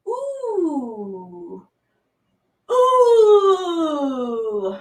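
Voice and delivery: rising loud ooh as you stretch. Shown as a demonstration. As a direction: let a big ooh out on the stretch. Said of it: "and say".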